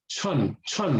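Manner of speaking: "chun" with a strong puff of air; aspirated ch. Shown as a demonstration. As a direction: let the air come out on each "chun". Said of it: "let the air come out".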